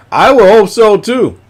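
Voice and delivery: high, loud emphatic wish; really mean it, voice jumping up; emphatic hope. Real, not acted. Streamer wishing something is finished, high and loud.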